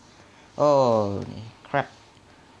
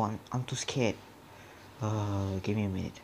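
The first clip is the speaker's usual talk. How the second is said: ordinary talk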